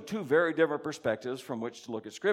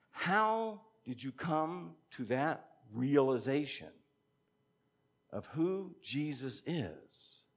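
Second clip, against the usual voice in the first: normal talking voice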